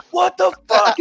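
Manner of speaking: very high, loud, drawn-out yell; imitated screaming. Drill instructor in a recruit's face, imitated screaming. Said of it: "just, like, screaming, dude, just screaming".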